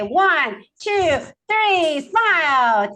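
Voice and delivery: high, drawn-out falling calls; photo countdown. Real, not acted. Host cueing smiles for the photo, drawn-out calls. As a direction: sing out each count to the group.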